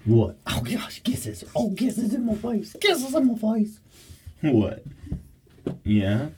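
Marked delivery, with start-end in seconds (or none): 1.5-3.8 high whispered coo. Real, not acted. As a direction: gush over the kisses, high and breathy. Dog licking her face.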